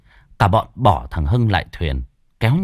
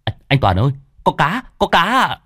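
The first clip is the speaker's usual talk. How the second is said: excited shout; 7 semitones higher than usual. A narrator's reading. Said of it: "một thằng hô to".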